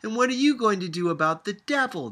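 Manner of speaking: loud, fast, high challenge; shouting. A narrator's reading. Sophia shouting a challenge at grandmother, fast and loud. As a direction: fire it off as a challenge. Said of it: "Sophia shouted".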